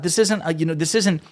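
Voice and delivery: ordinary talk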